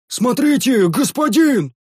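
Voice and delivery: high, urgent yell of alarm; yelling warrior. Acted. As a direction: cry out a warning to the lord. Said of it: "завопил".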